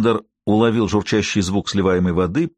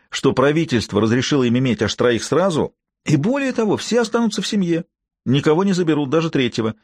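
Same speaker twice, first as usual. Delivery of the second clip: ordinary delivery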